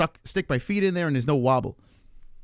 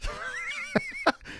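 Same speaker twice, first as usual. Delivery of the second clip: high laugh; 7 dB quieter than usual. Real, not acted. Laughing while telling story.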